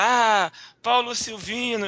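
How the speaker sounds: imitated fan shouting. Fans spotting a lookalike leaving the match, mock shouting. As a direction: call it out like a teasing crowd. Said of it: "começou a gritar".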